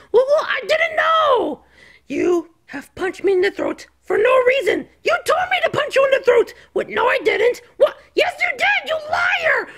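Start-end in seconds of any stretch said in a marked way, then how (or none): 0.1-1.6 high, drawn-out protest; whiny, stretched-out excuse; defensive whine. Acted. Repeats excuse when blamed. 5.0-6.5 fast, high, indignant comeback; worked-up, rapid accusation; heated retort. Acted. Heated argument over blame. 8.1-9.7 very high, shouted accusation; furious high-pitched cartoon yell; angry accusation. Heated argument over blame.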